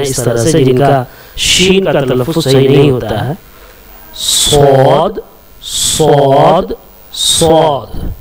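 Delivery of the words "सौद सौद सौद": सौद drawn out very slowly with a heavy, thick s; slow letter demo. Shown as a demonstration. Teacher slowly drawing out the letter for students. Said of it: "ये जो letter है".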